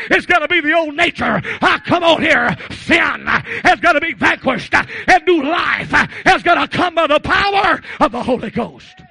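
high, loud revival cadence; driving, shouted pulpit exhortation; full-force preaching. Real, not acted. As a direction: punch out each phrase to rouse the congregation.

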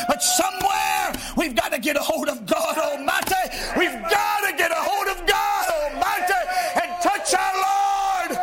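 rising, shouted gospel preaching; preaching at full force. Acted. Preacher to his congregation, shouting, fervent. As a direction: lift the congregation with fiery, shouting delivery.

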